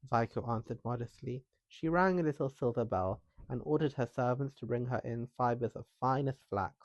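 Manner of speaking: usual speaking voice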